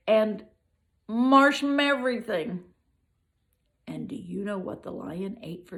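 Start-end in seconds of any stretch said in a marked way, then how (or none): 0.1-2.6 rising to a loud, higher cry on marshmeverything; the list peaks in a loud cry; building to a shout. A narrator's reading. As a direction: belt out the last word of the list.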